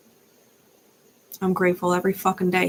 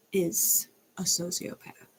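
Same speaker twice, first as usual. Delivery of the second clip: quiet correction; 8 dB quieter, slower than usual. Real, not acted.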